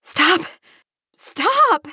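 high, urgent cries; screaming. A narrator's reading. Someone yelling at the man to stop, screaming.

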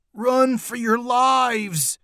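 high, drawn-out alarm cry; panicked high call to flee; shouted. A narrator's reading. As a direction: cry out a warning, stretching each word. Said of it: "shouted Neil".

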